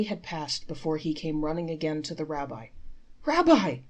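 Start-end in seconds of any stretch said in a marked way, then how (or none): 3.2-3.8 high, loud beaming call; joyful cry. Acted. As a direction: cry out his name in relief. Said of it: "cried the poor man".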